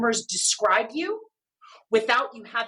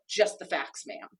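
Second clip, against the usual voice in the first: deadpan detective; 6 semitones lower, 5 dB quieter than usual. Retold or imitated. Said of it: "a bit more journalistic".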